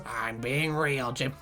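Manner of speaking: slow, low, soft strained croak; Monsters Inc slug. Acted. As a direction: drag it out from the throat like a tired monster. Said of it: "That murders my throat to do it".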